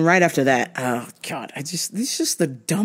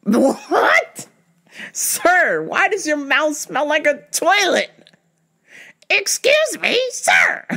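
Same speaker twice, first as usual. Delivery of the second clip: disgusted; 11 semitones higher, slower than usual. Retold or imitated. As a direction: recoil from the smell in disbelief. Disgusted reply to smelly breath.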